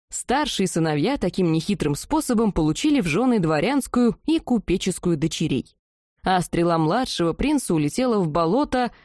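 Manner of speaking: usual speaking voice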